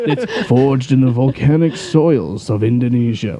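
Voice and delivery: slow, low-pitched superhero announcer. Acted. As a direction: read it slowly like a grand announcer. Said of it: "my announcer voice".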